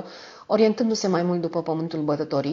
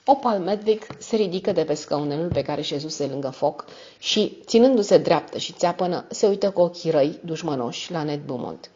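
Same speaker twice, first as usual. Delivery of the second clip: ordinary delivery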